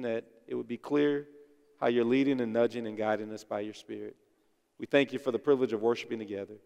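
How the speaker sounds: low, soft praying voice; prayer. Real, not acted. Leads the congregation in prayer.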